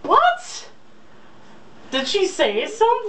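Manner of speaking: high, hammed-up startled what; mock surprise. Cohost interrupting the romance reading, mock startled. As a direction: play it as if the breasts just spoke.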